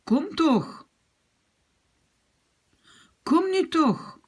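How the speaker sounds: teacher demoing rising anger; irritated to furious. Shown as a demonstration. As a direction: build from annoyed to furious. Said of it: "je les combine avec un verbe".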